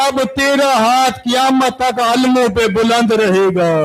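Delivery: sorrowful preaching chant, voice heavy with grief; lamenting recital. Acted. Preacher telling mourners of Abbas, grieving lament. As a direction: mourn each line as you tell it. Majlis narration of Karbala.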